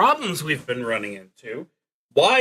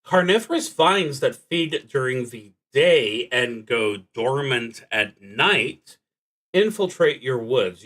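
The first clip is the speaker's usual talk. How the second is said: reading the prompt aloud, louder and fuller; card prompt reading; 6 dB louder than usual. Acted. Player reading the card's event to the listener, narrator style.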